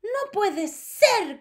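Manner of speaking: high, loud and slow disbelief; shouting. A narrator's reading. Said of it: "gritaron las demás".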